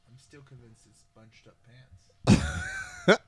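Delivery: drawn out, every word leaned on; slow emphatic insistence. Real, not acted. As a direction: insist slowly and loudly. Slow loud insistence, joking.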